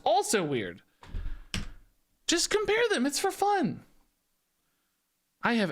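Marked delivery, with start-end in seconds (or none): 2.3-3.8 high, pleading exasperation; exasperated plea. Real, not acted. Streamer pleading with commenters, exasperated. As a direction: beg them to just enjoy it.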